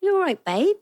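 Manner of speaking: cheeky Essex "you're all right, babe"; Essex accent. Pretend. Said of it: "Can you do an Essex accent?".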